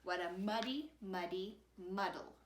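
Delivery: plain reading voice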